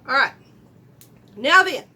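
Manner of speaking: loud call. Real, not acted. Makeup tutor calling to viewers as if to a full room, loud. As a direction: call out to the room like it's crowded. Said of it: "Am I screaming? I'm sorry for yelling".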